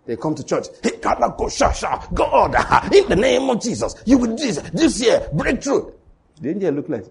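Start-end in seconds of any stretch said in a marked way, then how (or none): 0.8-5.9 high, pleading voice of a demanding worshipper; mock churchgoer prayer. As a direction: ham up a greedy prayer, much higher. Churchgoers praying for breakthrough.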